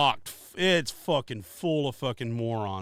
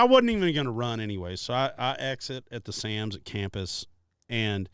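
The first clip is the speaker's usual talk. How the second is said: ordinary talk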